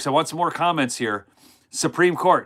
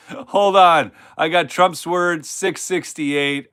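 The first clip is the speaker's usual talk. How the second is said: ordinary delivery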